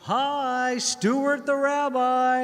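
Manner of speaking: high, very slow, drawn-out friendly greeting; rabbi impression. Narrator imitates the rabbi greeting them at the door, high and slow. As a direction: stretch each word like a gentle rabbi saying hello. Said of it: "This is what he sounds like. An approved impression".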